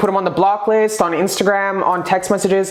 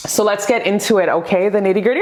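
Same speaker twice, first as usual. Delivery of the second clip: ordinary delivery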